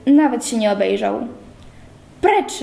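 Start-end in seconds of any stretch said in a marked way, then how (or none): 2.2-2.6 high, barked out; roared command. A narrator's reading. Bernard roaring at the intruder, angry bark. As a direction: roar it without looking back. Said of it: "ryknął".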